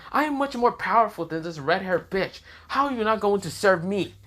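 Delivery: high-pitched haughty protest; indignant. Acted. Rival asks why Issei won't serve her, indignant. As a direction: act affronted, voice pitched well up.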